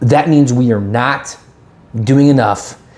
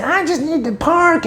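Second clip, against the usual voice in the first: very high, drawn-out mimicked woman; woman in car; 3 dB quieter than usual. Woman calling from her car window, high mimicked plea. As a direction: imitate a woman pleading in a high-pitched voice. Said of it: "the woman in the car".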